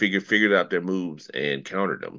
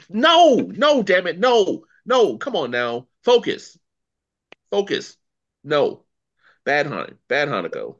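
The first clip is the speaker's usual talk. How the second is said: high, loud, drawn-out protest; exasperated scolding; 10 semitones higher, 7 dB louder than usual. Real, not acted. Playfully scolding, refusing to be triggered.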